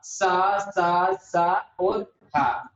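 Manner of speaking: "सा, सा, सा और हा" slowly, each vowel drawn out; slow teacher's sounding-out of "सा, सा, सा और हा"; pronunciation demo. Shown as a demonstration. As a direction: stretch each syllable of "सा, सा, सा और हा" separately. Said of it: "उच्चारण करके देखिए पहले इन चारों सदस्यों का".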